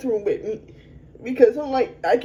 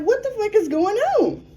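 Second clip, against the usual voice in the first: sudden high-pitched cry of disbelief; shocked outburst; 10 semitones higher than usual. Retold or imitated. To the police outside her home, a shocked high "what is going on". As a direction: blurt it out, stunned and much higher. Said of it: "I'm like".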